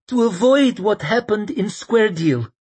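put-on high, anxious young man; earnest young man voice. Sufin voiced.

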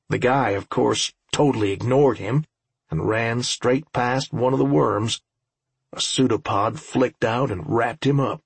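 ordinary delivery, nothing marked

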